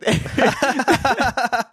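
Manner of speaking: high burst of group-style laughter; imitated roaring laughter. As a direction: laugh hard, with no trailing off. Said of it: "I don't feel like there was a ... trail off of the laughter".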